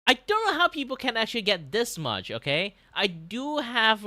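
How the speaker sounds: high, loud protesting; exasperated rant. Acted. Artist ranting to viewers about commission work, high loud exasperation.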